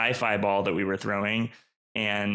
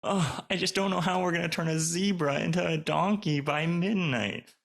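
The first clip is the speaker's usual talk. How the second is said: exasperated fantasy character; 7 semitones higher than usual. Acted. Repeats dramatic line.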